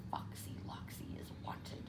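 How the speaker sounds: very soft whisper; whispered reading. A narrator's reading. Chicken Little recalling the wanted poster, whispering. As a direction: breathe the words in sudden realization.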